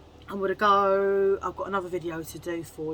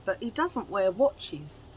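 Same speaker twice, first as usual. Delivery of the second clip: ordinary talk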